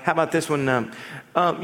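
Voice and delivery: ordinary talk, nothing marked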